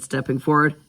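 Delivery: ordinary delivery, nothing marked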